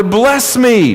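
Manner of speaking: high-pitched, mildly acted plea; bitter cry. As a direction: plead like a cheated son crying out. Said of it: "he cried out with an exceedingly great and bitter".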